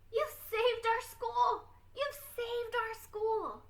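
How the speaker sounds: high, excited jubilant cry; jubilant roar. Acted. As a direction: shout it out in triumph. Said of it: "he jubilantly roared".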